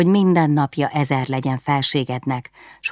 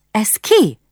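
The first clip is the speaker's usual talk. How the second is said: a startled, indignant demand; sharp interruption; 7 semitones higher, 4 dB louder than usual. Acted. The princess cuts off the rude servant, sharp and startled. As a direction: cut in sharply, higher and louder. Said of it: "Szakította félbe a fejedelem asszony".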